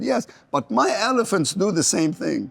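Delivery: imitated Italian caller, high and slow; Italian accent. Italian researcher pitching his elephants to an economist, imitated Italian accent. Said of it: "in his Italian accent".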